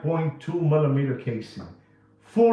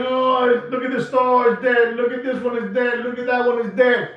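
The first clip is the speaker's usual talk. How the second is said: higher, louder mock of rival dealers; 8 semitones higher, 5 dB louder than usual. Mocking rival dealers filming dead stores, higher mock voice. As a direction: parody the copycats filming empty stores. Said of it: "And now they're recording".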